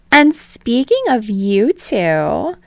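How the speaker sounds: high, loud, slow teasing segue; gossipy host. Acted. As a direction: draw it out to tease the gossip.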